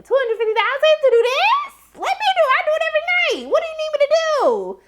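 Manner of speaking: very high, rushing, eager; squealing eager offer. Retold or imitated. Recapper joking she'd do it, very high and eager. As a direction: shriek it, volunteering, fast. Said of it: "I was just like".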